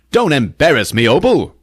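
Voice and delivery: high, loud comic protest; mock embarrassed husband. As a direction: protest to your wife in a high put-on voice. Husband scolds Opal in story.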